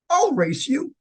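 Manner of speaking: a hint of a feisty old man taking the dare; old man's challenge. Elderly man in a wheelchair accepting the race, lightly voiced.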